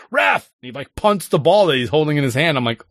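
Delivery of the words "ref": sharp high yell at the referee; a kid shouting for the ref's attention; calling the ref. Retold or imitated. Kid on the field yells at the referee, high and loud. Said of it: "and he's like".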